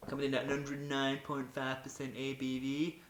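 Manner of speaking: A B V said in a mock Yorkshire accent; slow Yorkshire drawl on A B V; a Yorkshire twang on the figures. Shown as a demonstration. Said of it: "that's more like a Yorkshire accent".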